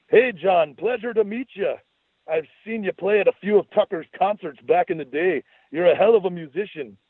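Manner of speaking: high, hammed-up Scottish brogue; a grinning fake Scotsman greeting a stranger; jokey Scottish accent, pitched high; goofy Scottish accent. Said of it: "stayed ensconced in the Scottish brogue".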